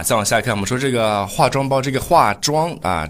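ordinary delivery

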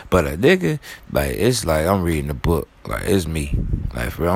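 ordinary delivery

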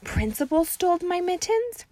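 high disbelieving question; shocked. Acted. Junie B. asking her teacher, high shocked question. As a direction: ask it stunned. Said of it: "very shocked".

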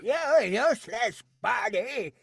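high, very slow villain imitation; Krang. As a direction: stretch it out like a cartoon villain. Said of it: "You sound like Krang".

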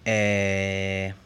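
low, drawn-out thinking eh; the eh you make when stuck for words; low thinking noise eh; hesitation eh. Shown as a demonstration. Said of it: "este ruido que hacemos cuando nos quedamos pensando".

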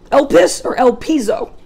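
elpis or elpizo, carefully sounded out; Greek words. Shown as a demonstration. Preacher reading the Greek words elpis and elpizo carefully. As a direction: try elpis, then elpizo, stress the first syllable of each. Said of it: "the Greek word for hope is".

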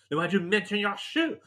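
confused wife with a touch of French; mock French wife. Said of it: "She said".

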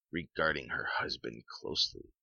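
ordinary delivery

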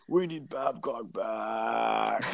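slow, soft crying whine; hammed-up sob for the old coach; mock wailing fan. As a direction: moan it like a heartbroken fan. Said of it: "fans will say".

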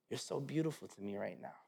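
soft, low murmur to a wife; tender. Retold or imitated. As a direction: murmur it lovingly, acted out. Tender words to wife in labour.

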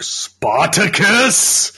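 drawn-out shout, high and loud; shouting Spartacus. Retold or imitated. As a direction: bellow the name slowly. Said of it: "you can be like".

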